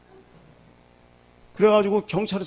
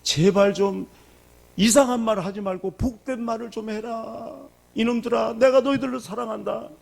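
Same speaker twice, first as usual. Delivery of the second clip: high, pleading 내가 너희들을 사랑한다; a loving father begging his children, high; 6 semitones higher than usual. Retold or imitated. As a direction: beg them, raised and earnest. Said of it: "죽으라 이런 말이 아니에요".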